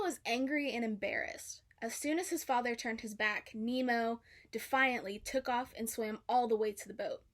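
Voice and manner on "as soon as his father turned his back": usual speaking voice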